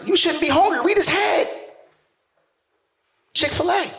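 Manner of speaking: high-pitched scolding wife; mock indignant woman's voice; exasperated wife. Wife scolding cousin, acted.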